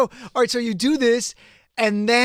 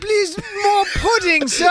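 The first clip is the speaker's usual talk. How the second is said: high, slow mock British accent; Mary Poppins British; 9 semitones higher, slower than usual. Co-host mocking the bad accent, as a pleading British character. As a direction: plead in a hammed-up English accent, pitched up. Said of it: "it's like Mary Poppins type".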